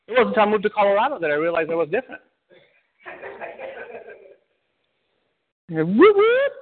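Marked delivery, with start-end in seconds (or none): 5.7-6.5 high, loud mock siren whoop; police siren whoop. Preacher recalls being pulled over, siren imitation. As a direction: imitate a cop car's siren call.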